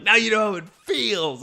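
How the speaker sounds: gleeful mock gloating through a laugh; excited. Retelling a movie scene they loved, excited. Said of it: "him getting excited about it".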